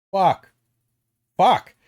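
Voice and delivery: sudden loud exasperated curse; frustrated swearing. Real, not acted. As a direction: blurt it out in frustration. Frustrated outburst mid-argument.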